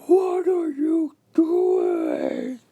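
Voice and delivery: high, slow furious shout; angry yell. Retold or imitated. Supervisor yelling at the worker, a drawn-out high shout. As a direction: bellow it like a charging boss. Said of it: "He's like".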